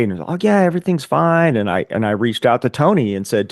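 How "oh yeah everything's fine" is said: higher-pitched casual reassurance; mock breezy denial; imitated brush-off. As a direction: wave the worry away, as a retold quote. Friend brushing off worried question.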